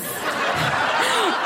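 high unvoiced giggle; breathy embarrassed laugh. Real, not acted. Laughing at own diary.

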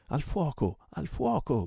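high, urgent fire alarm; shouting. A narrator's reading. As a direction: cry out high to raise the alarm. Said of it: "gridò il portoghese". (grido d'allarme acuto)

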